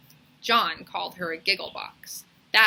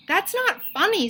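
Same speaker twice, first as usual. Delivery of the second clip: high, loud furious cry; angry protest; 12 semitones higher, 6 dB louder than usual. A narrator's reading. Dana yells at John and Joe who mocked her, angry. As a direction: yell it at the laughing boys. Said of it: "said Dana".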